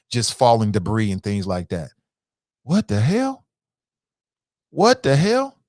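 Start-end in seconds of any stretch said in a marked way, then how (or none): 2.6-5.6 stunned disbelief. Real, not acted. Disbelief at the collapse.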